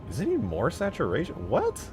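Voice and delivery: high-pitched, incredulous questioning; surprised. Real, not acted. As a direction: let your voice leap up in disbelief. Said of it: "I'm surprised".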